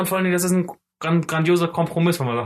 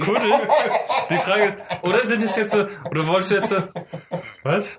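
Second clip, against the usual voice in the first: laughing; 6 semitones higher than usual. Real, not acted. Laughing over odd word.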